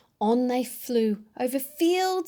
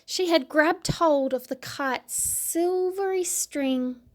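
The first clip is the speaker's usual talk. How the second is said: usual speaking voice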